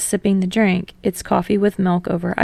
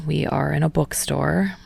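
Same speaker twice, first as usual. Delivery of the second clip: low, softer dry quip; deadpan and amused; 4 semitones lower, 4 dB quieter than usual. A narrator's reading. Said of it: "Tsukishima says amusedly".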